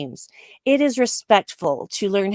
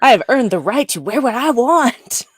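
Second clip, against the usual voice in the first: emphatic, higher-pitched proclamation; mock defiant; 4 semitones higher, 4 dB louder than usual. Old lady defending her wardrobe to critics, mock defiant. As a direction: declare it with playful indignation.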